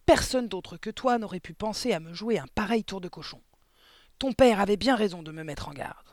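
high-pitched headmistress tirade; roaring accusation. A narrator's reading. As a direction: accuse with loud indignation. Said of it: "rugit mademoiselle Le Gourdin".

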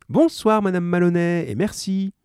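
high, lightly voiced shopkeeper; friendly grocer. A narrator's reading. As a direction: chat like a helpful grocer. Grocer says goodbye.

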